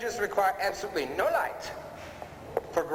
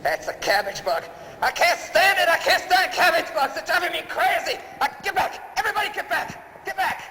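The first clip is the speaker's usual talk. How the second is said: much higher and louder, shrieking in mock terror; hammed-up panic; 12 semitones higher, 5 dB louder than usual. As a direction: scream it like the bugs are swarming you. Panics over cabbage bug.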